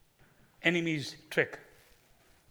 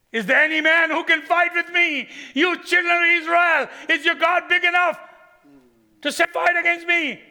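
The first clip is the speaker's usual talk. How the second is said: much higher, loud shouted challenge; giant's taunt; 11 semitones higher, 8 dB louder than usual. Acted. Goliath shouts his challenge to Israel's army. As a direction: bellow the challenge across the valley.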